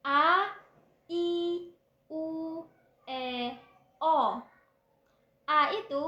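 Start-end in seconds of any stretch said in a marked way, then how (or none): 0.1-4.4 A, I, U, E, O each opened wide and loud; "A, I, U, E, O" sung out clearly one by one; clear vowels. Shown as a demonstration. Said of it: "Vokal yang jelas itu ada". (vokal A, I, U, E, O diucapkan jelas dan lantang)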